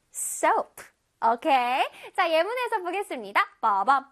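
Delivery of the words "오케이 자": usual speaking voice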